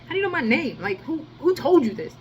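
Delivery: retold confused demand. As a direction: ask it in disbelief. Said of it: "I was just like".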